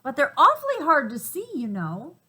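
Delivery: fussing little monkeys. Acted.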